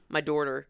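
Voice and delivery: DAUGHTER said as dorter; my daughter, rhyming with porter; odd daughter. Retold or imitated. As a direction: put an r into daughter. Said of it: "Instead of daughter, you're like".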